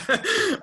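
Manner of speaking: short laugh. Real, not acted. Laughing at own story.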